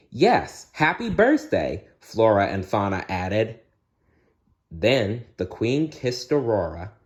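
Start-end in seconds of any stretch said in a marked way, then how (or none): 0.1-1.8 high, cheerful fairy chorus; high fairy voices. Acted. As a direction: chime in as little fairies. Fairies Flora and Fauna greet.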